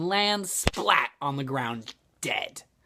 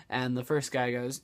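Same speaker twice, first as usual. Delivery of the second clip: ordinary delivery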